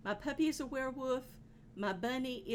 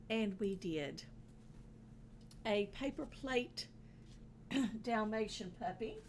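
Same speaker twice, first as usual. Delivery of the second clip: ordinary delivery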